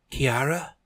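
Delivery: high, loud questioning call; startled call. Acted. Tristan behind Kiara, a high startled call of her name.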